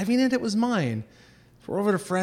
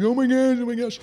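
panicked friends; 9 semitones higher than usual. Retold or imitated. Friends panicking in cop car.

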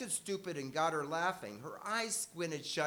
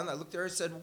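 normal talking voice

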